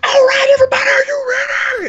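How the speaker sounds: high, loud hype call from the ride's announcer. Retold or imitated. Ride recording hyping up riders, high and loud. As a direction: rev up the riders like a theme-park announcer. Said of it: "and it's like".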